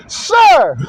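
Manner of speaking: a high, loud barked "Sir!"; hammed-up military shout; soldiers shouting. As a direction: snap to attention and bellow it. Soldiers shout in Spaceballs scene.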